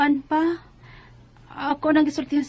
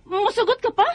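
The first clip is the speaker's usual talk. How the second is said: can't believe her mother agrees; shocked disbelief; 9 semitones higher than usual. Acted. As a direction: pitch jumps high in disbelief. Daughter shocked by marriage news.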